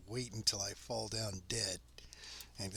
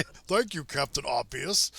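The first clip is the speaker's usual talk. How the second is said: dry mock thanks, pitched up on thank you; sarcastic; 6 dB louder than usual. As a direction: roll your eyes at the game. Mocking the game's obvious hint.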